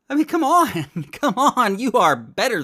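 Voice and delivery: high, loud, exasperated plea; exasperated appeal. Real, not acted. Host pleading with those who accept lies, high and exasperated. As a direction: implore them, pitched right up.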